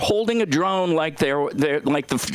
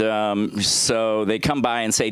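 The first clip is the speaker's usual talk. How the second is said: ordinary talk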